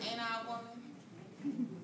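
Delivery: slow, weighty quoted line; lightly voiced historic orator; Sojourner Truth. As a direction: lean into it slowly like a preacher. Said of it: "I take cues from people like Sojourner Truth".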